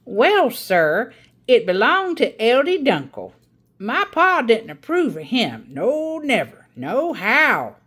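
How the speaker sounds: put-on a proud old aunt holding the children spellbound; Aunt Ada's storytelling voice. Aunt Ada tells her tale.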